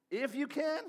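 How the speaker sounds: incredulous echo. Retold or imitated. Jesus echoing the father in disbelief. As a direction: toss the words back in disbelief. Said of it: "said Jesus".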